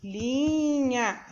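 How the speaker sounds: "galinha" slow, with ga and linha held; ditado silabado. Shown as a demonstration. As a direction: dictate "galinha" slowly, stretching each part. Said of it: "Vamos escrever". ("galinha" devagar, como num ditado)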